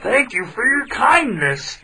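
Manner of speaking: high, lightly voiced tiger; freed tiger thanking the traveler; tiger's voice. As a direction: thank him sweetly. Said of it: "said the tiger".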